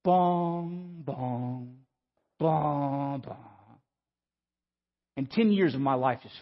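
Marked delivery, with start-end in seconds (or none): 0.0-3.4 slow, drawn-out piano bongs; imitated piano notes. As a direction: intone low, ominous piano notes, letting each ring. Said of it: "the piano begin to play".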